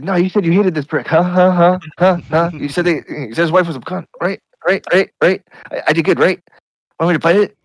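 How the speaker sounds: high-pitched, excitable dog voice; over-eager dog. Acted. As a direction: badger them like a dog desperate to please. Dog eagerly embarrassing and pleasing owner.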